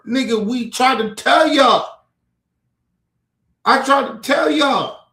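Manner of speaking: loud, high, fed-up shout; exasperated yelling. Real, not acted. Shouting at Trump voters who ignored his warnings, exasperated. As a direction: yell it at them, told-you-so.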